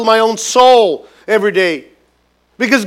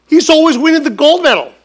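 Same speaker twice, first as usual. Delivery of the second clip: much higher, louder, whining complaint; mock defeated David; 8 semitones higher, 5 dB louder than usual. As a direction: play a sore loser envying the champion. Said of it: "David felt".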